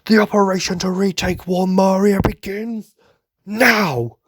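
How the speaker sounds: high, loud declaimed order; commander's rallying proclamation. Retold or imitated. Imitating Irvin launching the operation, shouting. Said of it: "he's like".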